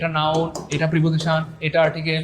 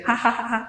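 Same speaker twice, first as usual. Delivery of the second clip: high staccato mock "ha-ha" laugh; imitated laugh; 6 semitones higher than usual. Teacher reading out a student's laughing reaction, mock ha-ha laugh. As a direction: act out a student's laugh in high, even bursts. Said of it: "হাসি দিয়েছেন".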